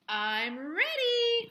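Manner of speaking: slow, high, drawn-out announcement; eager cry. A narrator's reading. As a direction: proudly announce you're ready. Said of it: "cried Froggy".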